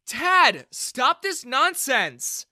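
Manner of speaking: high, loud, drawn-out scolding; angry shout. A narrator's reading. Daughter orders father to stop, angry shout. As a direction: cut in, shouting in exasperation.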